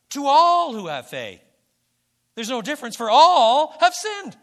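preacher's climax, all have sinned; impassioned preaching. Real, not acted. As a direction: pound all and sinned like a preacher at full force.

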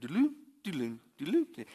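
sung-out computer alert noise; imitated message chime. Father recalls daughter's instant messaging, imitated alert chime. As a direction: mimic an instant-messenger chime in quick little notes. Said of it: "whenever they get a message".

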